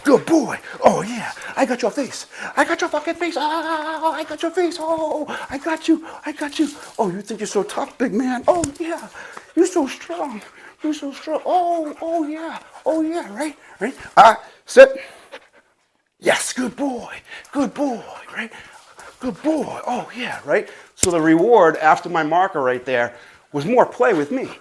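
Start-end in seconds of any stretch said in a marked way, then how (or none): 0.0-13.9 high, sing-song voice for the dog. Real, not acted. Owner baby-talking to his dog. 14.2-15.0 loud, snapped dog command; sharp command. Real, not acted. 16.2-20.7 high, sing-song voice for the dog. Real, not acted. Praising the dog.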